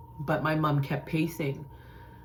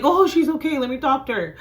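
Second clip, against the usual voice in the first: loud, high-pitched outburst; hysterical; 10 semitones higher, 7 dB louder than usual. Retold or imitated. Antsy sister demanding the phone, hysterical. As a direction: burst out, pitch leaping up, then easing slightly. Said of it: "And I'm, like, hysterical".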